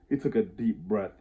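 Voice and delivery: ordinary delivery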